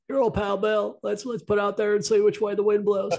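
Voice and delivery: smarmy politician. Retold or imitated. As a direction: play the folksy old pal selling a line. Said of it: "It sounds just like".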